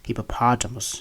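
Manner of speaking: hippopotamus with a long AH in POT; American pronunciation. Shown as a demonstration. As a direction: say hippopotamus as hippo-PAH-tuh-mus. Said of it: "In American English".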